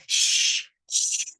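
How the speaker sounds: imitating an adult's sharp shush; mock adult shush. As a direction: hush the children like an annoyed adult. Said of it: "an adult going".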